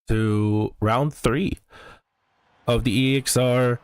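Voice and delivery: normal talking voice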